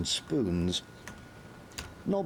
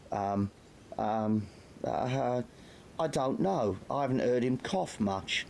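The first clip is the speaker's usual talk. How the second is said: higher, stammering lad; nervous; 8 semitones higher than usual. A narrator's reading. Nobby dodges Carter's question, nervous. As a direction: stall with fear.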